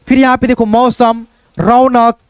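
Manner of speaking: normal talking voice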